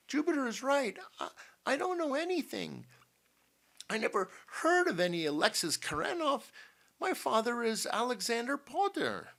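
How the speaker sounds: high-pitched, flustered woman protesting; anxious Eloise. A narrator's reading. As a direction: stammer a high, earnest denial. Eloise protests her ignorance.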